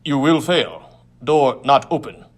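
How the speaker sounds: alien gang leader. Acted. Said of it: "said the last member of our little group".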